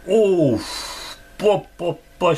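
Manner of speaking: high, loud excited outburst. Real, not acted. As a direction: burst out with surprise. Surprise find of a card.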